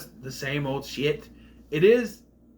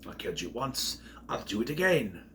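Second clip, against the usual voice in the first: low, menacing villain voice, lightly voiced; a lightly imitated villain growling a threat; 3 semitones lower, 6 dB quieter than usual. Villain Rickman threatening the Professor, low and menacing.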